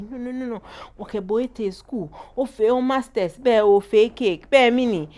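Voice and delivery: upset mother. Retold or imitated. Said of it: "My mother was like".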